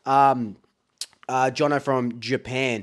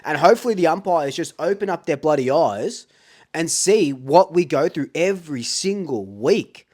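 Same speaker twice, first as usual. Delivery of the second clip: usual speaking voice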